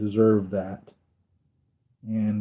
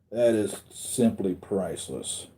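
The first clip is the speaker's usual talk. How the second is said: ordinary talk, nothing marked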